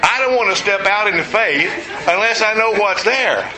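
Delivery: talking through leftover laughter; words broken by real laughter; laughing. Real, not acted. Preacher still laughing as he returns to the point.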